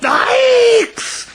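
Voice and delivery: high, loud shocked yelp; startled yell. A narrator's reading. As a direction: jump back in alarm. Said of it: "Her father turned around and yelled".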